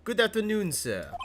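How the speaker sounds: a polite French hotel bellboy greeting; hammed-up French accent, bowing and scraping. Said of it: "I get to do a French accent".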